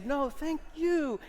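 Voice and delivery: high, slow, exaggerated gratitude; hammed-up returned thanks; gushing thank you back. Shown as a demonstration. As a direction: outdo their thanks theatrically. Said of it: "And I'm gonna say".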